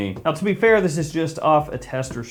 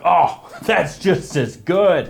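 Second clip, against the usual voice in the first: mock gushing; 6 semitones higher, much slower than usual. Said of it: "grabs me immediately like".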